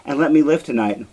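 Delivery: a wife bargains with her husband for her life, frantic; frantic bargaining. Acted. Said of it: "Desdemona proposes an alternative plan".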